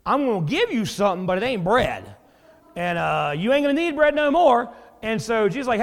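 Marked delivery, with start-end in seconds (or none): 3.4-4.7 higher, louder mock-menacing punchline; mock threat. Preacher jokingly warns a late-night beggar, loud mock menace.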